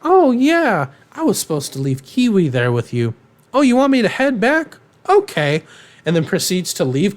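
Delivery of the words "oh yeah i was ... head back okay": high mock phone chat. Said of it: "He's just like".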